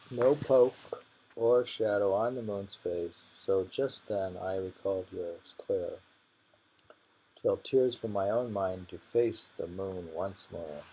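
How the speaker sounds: reading a short poem aloud with feeling; measured poetry recital. Acted. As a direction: give each line its weight, like verse.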